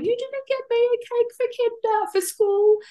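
put-on crying child voice. Mum imitating her crying son in the car, high whiny voice. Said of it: "he started crying in the car going".

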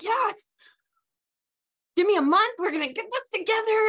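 high, loud and fast, gung-ho; overeager. Retold or imitated. Boasting to God she will be done in a month, high, loud and eager. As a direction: bounce in like a cheerleader ready to win.

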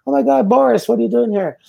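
high, excited "Oh my God, Boris"; surprised greeting. Retold or imitated. Colleagues spotting him on the bus, surprised and high. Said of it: "they saw".

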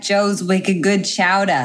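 put-on slow, drawn-out New England accent; slow put-on Boston-area accent. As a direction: relish the dropped r like a New Englander.

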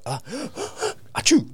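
high gasping fake sneeze; ah then a big pretend achoo; mock sneeze. Shown as a demonstration. Explaining anxiety as a sneeze, mock sneeze. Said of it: "you're like".

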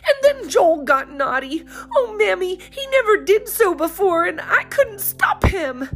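put-on high, tearful young girl; pleading through tears; great distress. A narrator's reading. Polly telling mother about Joel, distressed. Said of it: "cried Polly in great distress".

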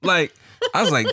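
usual speaking voice

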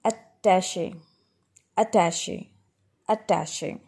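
"attache" as a-TASH-ay; correct SH sound. Shown as a demonstration. Teacher repeating for learners, attache with SH. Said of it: "This is pronounced as".

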